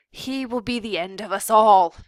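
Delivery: loud angry father shout. A narrator's reading. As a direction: bellow his despair. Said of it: "he shouted".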